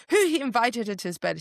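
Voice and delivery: quick, high, loaded question; hammed-up jealous nosiness; mock jealous. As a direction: blurt it out like a jealous onlooker. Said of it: "jealous again".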